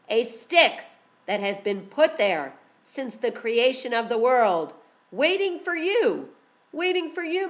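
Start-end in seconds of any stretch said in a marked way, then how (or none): 5.1-7.5 sing-song. A narrator's reading.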